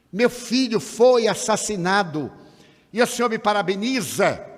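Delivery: retold outraged cry. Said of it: "ela gritou".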